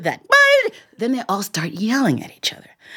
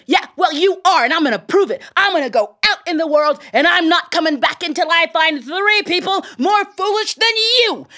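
angry tailor; 7 semitones higher than usual. Acted. Tailor storming at his in-laws, angry and building. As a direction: throw the challenge back at them, building in anger. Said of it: "the tailor was so angry".

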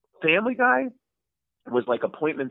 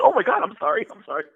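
flustered apology; 7 semitones higher, faster than usual. Retold or imitated. Flustered apology on being dismissed.

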